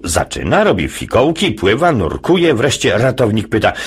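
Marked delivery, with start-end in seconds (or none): none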